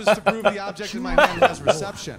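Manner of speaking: loud, building rant while laughing. Acted. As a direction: push it loud and gleeful, slowing down. Escalating comic rant.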